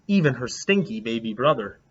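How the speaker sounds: usual speaking voice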